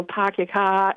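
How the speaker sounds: attempted Boston accent with dropped R's. Shown as a demonstration. Caller showing how Boston would say it, Boston accent. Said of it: "I don't do a good Boston accent".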